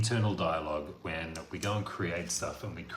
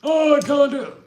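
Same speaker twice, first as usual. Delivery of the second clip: whiny mock excuse; 13 dB louder than usual. Mocking people who give up.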